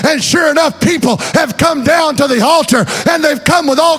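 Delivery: rising to a loud, high shouted sermon; preaching at full force. Acted. Preacher shouting faith to the congregation, full-force preaching. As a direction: preach it out to the whole church.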